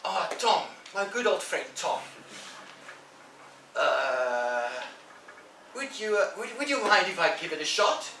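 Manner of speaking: butter him up and stumble over the ask; wheedling Ben. Acted. Ben sweet-talking Tom to get a turn, hesitant and pleading.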